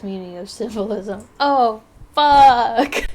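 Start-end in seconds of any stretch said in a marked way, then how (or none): 1.4-3.1 loud, high startled curse. Real, not acted. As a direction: blurt it out in shock. Sudden mistake while drawing.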